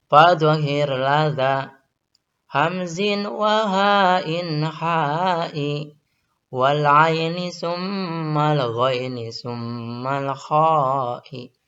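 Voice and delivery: slow chanted Arabic verse; recited verse. Shown as a demonstration. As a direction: recite it slowly like a tajwid chant. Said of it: "Bacalah idhar ataupun jelas kamu". (lantunan syair tajwid yang lambat)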